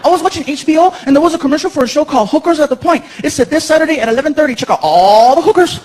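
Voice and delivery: put-on high child voice; little boy. Son explains the TV commercial, little boy voice. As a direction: recount it like an eager child.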